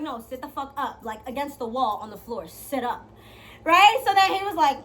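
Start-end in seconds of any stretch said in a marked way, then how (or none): 0.0-3.1 hushed bossy command. Retold or imitated. Quoting her own bedroom command.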